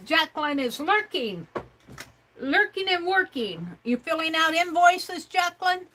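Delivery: high, singsong teasing call-out to a viewer; playful teasing. Real, not acted. Teasing Jacqueline in chat for lurking, high singsong. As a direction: tease her sweetly, pitched well up.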